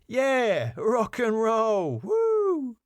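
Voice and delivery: high, drawn-out party whoop; slow, high rock-fan holler; whooping cheer. Acted. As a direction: cheer like a rowdy reveller. [name] joins in the cheering.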